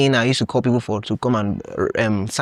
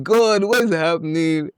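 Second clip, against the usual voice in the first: higher-pitched mock despairing plea; retold panic; 4 semitones higher than usual. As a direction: replay your panicked cry to God. Retold panic, head was spinning.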